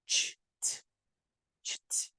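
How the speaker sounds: voiceless "ch", then a separate "t"; isolated CH-T sound. Shown as a demonstration. Teacher breaking down the hard ending, voiceless "ch-t". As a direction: break it into bare "ch" and "t", no voice. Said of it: "você tem que falar".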